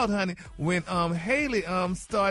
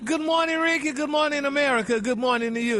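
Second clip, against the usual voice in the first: bright, loud, higher showbiz hello; gossip host greeting; 5 semitones higher, 4 dB louder than usual. Acted. Gossip host greeting the morning show audience with sass. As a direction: open the segment with sass.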